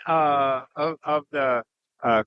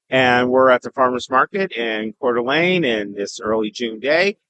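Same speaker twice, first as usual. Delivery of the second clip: ordinary talk, nothing marked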